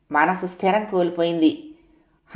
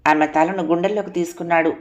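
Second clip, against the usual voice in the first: plain reading voice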